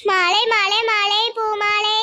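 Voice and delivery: drawn-out flower seller's call; street vendor's cry. Acted. Flower seller hawking garlands to passers-by, drawn-out vendor's cry. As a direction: call out your wares, stretching the words.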